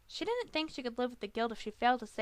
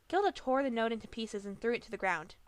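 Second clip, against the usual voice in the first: usual speaking voice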